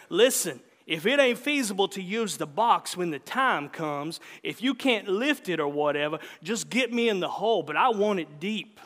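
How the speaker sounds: lightly voiced stubborn old man giving orders; old man's orders. Old man gives burial orders.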